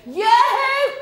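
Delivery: high, loud laughing call; shouted yoo-hoo. Retold or imitated. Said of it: "If I hear them going".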